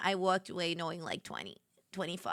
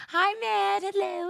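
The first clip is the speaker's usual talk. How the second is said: excited greeting; 10 semitones higher, 5 dB louder than usual. Real, not acted.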